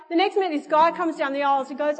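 ordinary delivery

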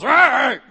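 loud, high playful "rawr" roar; a cartoonish beast roar; mock roar. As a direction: roar like a toy monster.

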